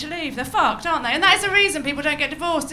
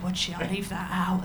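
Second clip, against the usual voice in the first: normal talking voice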